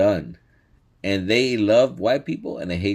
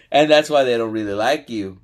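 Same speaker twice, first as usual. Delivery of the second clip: usual speaking voice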